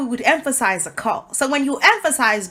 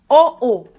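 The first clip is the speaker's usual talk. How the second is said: "oh, oh" loud, the first strong, the second falling; greater emphasis; 3 semitones higher, 7 dB louder than usual. Shown as a demonstration. Teacher showing the stronger call, greater emphasis. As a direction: punch "oh, oh" as two beats. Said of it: "a greater emphasis".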